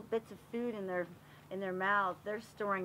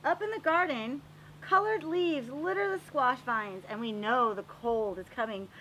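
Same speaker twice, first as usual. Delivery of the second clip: storybook narration; 5 dB louder than usual. Acted. Teacher reading a garden book aloud to the class, storybook voice. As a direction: read it like a picture book for little kids. Reads picture book to children.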